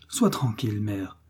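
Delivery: plain reading voice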